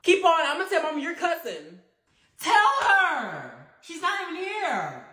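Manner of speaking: ordinary talk, nothing marked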